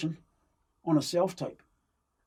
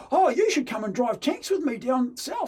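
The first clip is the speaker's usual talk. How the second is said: high, loud, friendly invitation; eager invite; 9 semitones higher, 5 dB louder than usual. Retold or imitated. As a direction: invite him like a playmate. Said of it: "And he goes".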